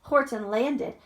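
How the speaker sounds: plain reading voice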